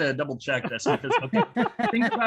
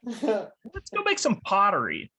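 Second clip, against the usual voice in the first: put-on high, childlike voice, slow and wide-eyed; dad mimicking his daughter in the car; imitating young daughter; 7 semitones higher, slower than usual. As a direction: play the innocent little girl reading a sign. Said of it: "my daughter tells me".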